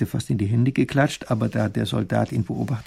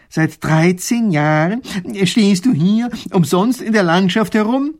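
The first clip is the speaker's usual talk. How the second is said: slow, higher, louder disbelief; incredulous; 8 semitones higher, 8 dB louder, slower than usual. Acted. Professor asks the soldier in slow disbelief. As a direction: stretch it out, unable to believe. Will es nicht glauben.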